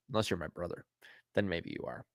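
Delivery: normal talking voice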